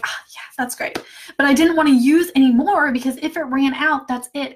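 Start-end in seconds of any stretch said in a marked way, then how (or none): 0.0-1.0 hushed, breathy-free whisper of mock contentment; whispered aside. Pretending the lotion is enough, whispered. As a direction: whisper it to yourself, savoring. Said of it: "and be like".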